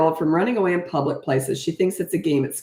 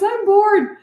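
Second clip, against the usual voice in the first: put-on high, loud bored whine from a little girl; whiny complaint; 5 dB louder than usual. Mother imitates her daughter complaining of boredom, whiny and high. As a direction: sing it out like a bored kid. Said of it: "didn't say it in quite the snottiest tone".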